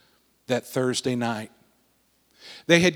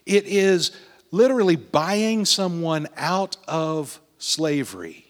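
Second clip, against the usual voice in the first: ordinary delivery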